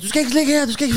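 high-pitched imitation of a stressed neighbour; urgent, panicky warning, acted out; mock-frantic neighbour voice. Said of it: "Helt, øh, stress".

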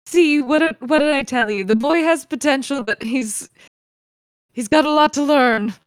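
lightly voiced older mentor making a point; lightly put-on, knowing assessment; Eloise, self-satisfied overseer.

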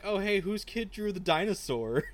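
high, bright-eyed innocent question; lab assistant voice. Retold or imitated.

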